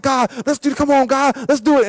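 a keen, high-pitched appeal to God; eager urging. Acted. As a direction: act out impatient enthusiasm. Acting himself eagerly urging God.

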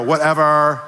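put-on drawn-out bored Valley girl "Whatever"; "Whatever" said like a Valley girl, bored and falling. Shown as a demonstration. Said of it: "Say it like a Valley girl".

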